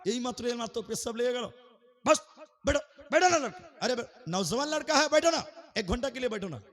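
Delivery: high, loud, rapid mock scolding; angry telling-off. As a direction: imitate an enraged preacher ordering someone down. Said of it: "বক্তা আরো রেগে গিয়ে বলছে".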